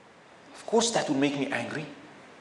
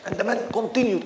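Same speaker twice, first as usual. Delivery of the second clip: normal talking voice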